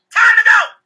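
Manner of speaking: very high, very loud announcing yell; acted furious wife's holler; mad shout. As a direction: scream it angrily across the party. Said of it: "She get mad, stood up and said".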